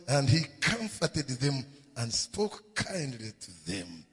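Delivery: usual speaking voice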